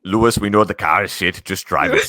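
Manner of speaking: loud mock-Austrian team boss; exasperated radio order, hammed up; Toto. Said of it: "Toto just goes".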